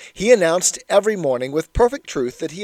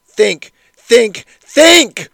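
building shout, each think louder and higher; a furious schoolmaster shouting, rising to a roar; crescendo shout; 6 semitones higher, 10 dB louder, much slower than usual. A narrator's reading. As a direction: bang the desk and roar it up to the last think. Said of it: "shouting in a crescendo".

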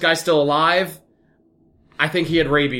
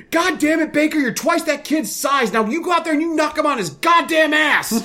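the coach yelling at a fourth grader to hit harder; 12 semitones higher than usual. Retold or imitated. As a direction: bark it like an angry football coach. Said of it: "he just goes".

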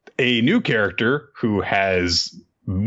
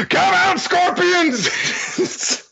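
calling out; much slower than usual. Acted. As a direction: yell it out, searching the woods. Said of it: "running through the forest going".

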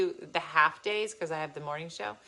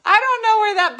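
excited; 13 dB louder than usual. Real, not acted. Laughing, excited about intercom.

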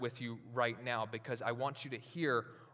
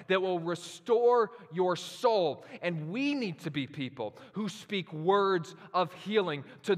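higher, louder, slow preaching build; preaching at full force; 6 semitones higher, 7 dB louder than usual. Acted. Pastor urging the church to speak healing, full-force preaching. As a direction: drive it home to the congregation.

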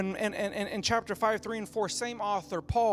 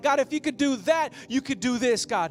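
fervent prayer; 2 semitones higher, 5 dB louder than usual. Acted. Voiced prayer in the sermon.

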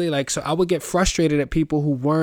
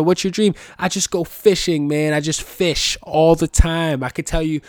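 high, loud, easygoing mock fan; lightly voiced chill fishing dude; higher, louder relaxed dreamer; laid-back fisherman; 5 semitones higher, 6 dB louder than usual.

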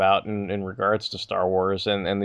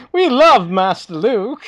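high, loud, slow robot adoration; fawning droid; 13 semitones higher, 9 dB louder, slower than usual. Pretend. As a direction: gush like a servile droid. Droid voice parody.

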